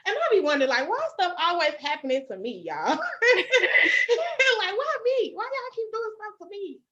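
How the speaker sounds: high, half-laughing "why me" lament; exasperated wailing. Real, not acted. Venting to viewers about her bad luck, exasperated. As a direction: wail it in comic despair.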